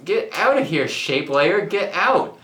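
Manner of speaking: usual speaking voice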